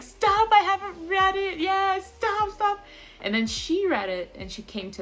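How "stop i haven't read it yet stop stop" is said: very high, loud squeal; friend begging. Retold or imitated. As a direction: plead to stop the spoilers. Voicing friend avoiding spoilers.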